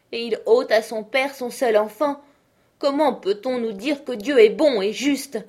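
high, loud despairing father; old father mourning his only child; grief-stricken lament. A narrator's reading. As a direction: rail at God in grief. Father laments over his daughter.

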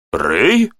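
questioning cry of recognition; mild astonishment at a familiar face; surprised exclamation. A narrator's reading. Lieutenant recognises his old friend Rey, exclaiming in surprise. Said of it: "воскликнул лейтенант".

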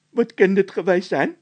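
very high, loud scared voice; a nervous squeaky henchman; squeaky frightened De Wit. A narrator's reading. (De Wit, piepend hoog en bang)

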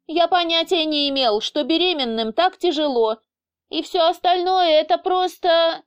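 high, agitated protest left hanging; breaking off. A narrator's reading. Philipp says pregnancy is hard, then breaks off, agitated. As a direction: start explaining, then stop short. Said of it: "начал Филипп и осёкся".

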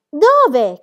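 high, very loud blurt; startled question. Acted. Climbing Book asking Pippi after her warning, startled. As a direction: blurt it out in alarm.

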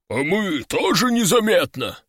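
lowing demon buffalo voice; mooing buffalo. A narrator's reading. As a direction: moo the words like a giant buffalo. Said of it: "промычали огромные демонические буйволы".

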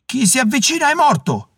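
high-pitched menacing call; threatening cry, pitch thrown high; snarled threat. A narrator's reading. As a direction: warn attackers off with an acted snarl. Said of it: "ringhiò dalla cassetta".

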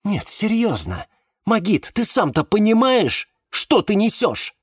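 high-pitched exasperated challenge; indignant. A narrator's reading. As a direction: protest indignantly, pitch jumping up. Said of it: "возмутилась Валериана". (возмущённо, высоким голосом)

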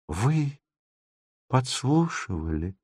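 soft, halting reproach; quietly accusing question; reproachful. A narrator's reading. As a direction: ask it hushed, wounded, with a pause. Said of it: "укоризненно глядя ему в лицо".

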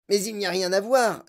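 put-on complaining boy. Boy objects to the inventor's boast, complaining. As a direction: protest like an unimpressed kid.

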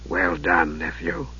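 low, soft, sinister mage; Raistlin's voice. Acted. Raistlin greeting his nephew, low and softly sinister. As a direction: hush it like a frail dark wizard.

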